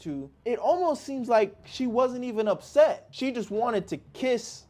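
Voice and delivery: loud, high, worked-up telling; reliving the confusion out loud; hella confused. Real, not acted. Telling friends the breakup, loud and worked up.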